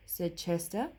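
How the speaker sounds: usual speaking voice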